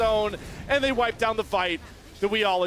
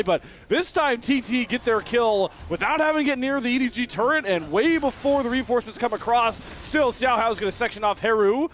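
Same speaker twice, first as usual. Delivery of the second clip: animated, higher-pitched kill call; excited esports fight commentary; live play-by-play; 5 semitones higher, 4 dB louder than usual. Real, not acted.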